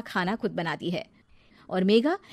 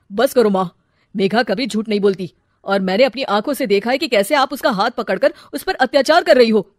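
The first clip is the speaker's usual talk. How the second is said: rebuking; 9 dB louder than usual. Acted. Son rebukes mother.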